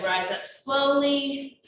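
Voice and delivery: usual speaking voice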